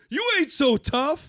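high, loud, drawn-out jeer; mock taunt. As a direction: taunt him loudly, stretching the words out. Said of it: "the next guy that scores on him".

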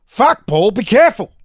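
high, loud panicked yell. A narrator's reading. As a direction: yell it while holding on. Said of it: "yelled Barry".

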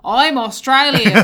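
mock Australian accent, slow and loud. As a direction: ham up a lightly done Australian drawl. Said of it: "he did a Hugh Jackman Australian".

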